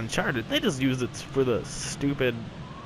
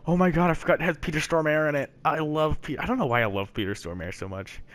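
usual speaking voice

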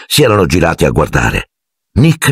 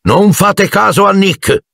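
high, slow calling voice; raised-pitch jovial cry; shouted aside. A narrator's reading. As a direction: call out mockingly to the guests. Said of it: "gridò la testa di Sir Patrick".

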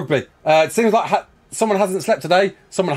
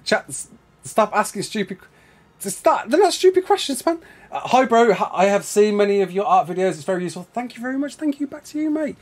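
usual speaking voice

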